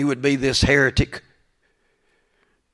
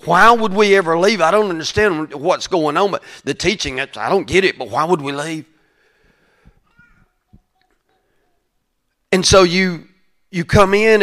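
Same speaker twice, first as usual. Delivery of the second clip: higher, louder sermon delivery; impassioned preaching; 6 semitones higher, 6 dB louder than usual. Acted. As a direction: preach it with force, driving each phrase home. Preaches with rising force.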